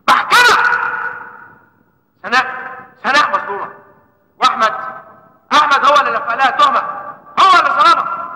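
loud, high courtroom outcry; frantic shout. Acted. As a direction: cry out to the court.

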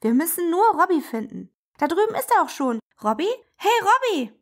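high, fast, eager little train; a bright little engine calling out to a friend. Acted. (der kleine ICE ruft eifrig nach Robby)

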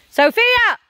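loud, high-pitched call. Real, not acted. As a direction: call out sharply to get Sophia's attention. Calls out to Sophia mid-video.

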